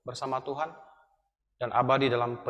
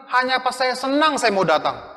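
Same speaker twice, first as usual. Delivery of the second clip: loud, high, slow mockery; preacher scorning the excuse, high and slow; mocking quote; 10 semitones higher, 9 dB louder than usual. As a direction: drag the excuse out in scorn, louder. Quoting congregation's excuse mockingly.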